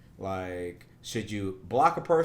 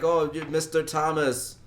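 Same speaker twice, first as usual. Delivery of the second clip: high, loud scammer impression; the pushy caller demanding details; 5 semitones higher, 4 dB louder than usual. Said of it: "He's like".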